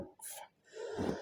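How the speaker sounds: a breathy, barely audible curse; whispered curse. Retold or imitated. Alone after the doctor leaves, a whispered stunned curse. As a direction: let it slip out under your breath. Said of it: "And I was still like".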